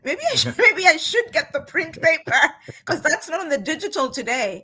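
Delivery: real giggle bubbling up mid-sentence; laughing. Real, not acted. Guest laughing as she admits missing the story. Laughing at own paper habit.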